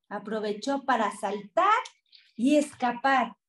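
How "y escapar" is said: ordinary delivery, nothing marked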